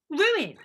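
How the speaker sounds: Ruined said loud and falling; emphatic. Real, not acted. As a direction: punch out the word Ruined alone. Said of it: "the whole thing is ruined".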